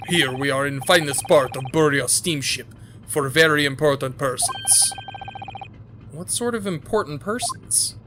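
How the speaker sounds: put-on gruff Russian-accented guard, proud; guard showing off the first-class cabins, Russian accent. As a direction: boast like a self-important Russian guard. Sailor voice.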